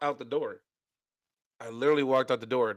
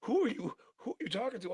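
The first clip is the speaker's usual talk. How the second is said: lightly acted higher-pitched challenge; higher mock interrogation; imitating Mo; 10 semitones higher than usual. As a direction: quote the questioning in a raised pitch. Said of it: "and Mo goes".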